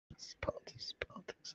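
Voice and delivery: breathy conspiratorial whisper of politics. Acted. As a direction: drop to a secret whisper on politics. Repeating politics, then whispering it.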